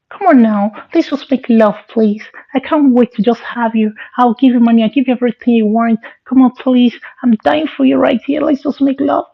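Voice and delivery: put-on high, pleading girly voice. Possessed friend begs the guy in a high girly voice. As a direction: beg like a lovesick girl. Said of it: "He was still talking like a girl".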